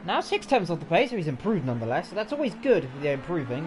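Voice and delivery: usual speaking voice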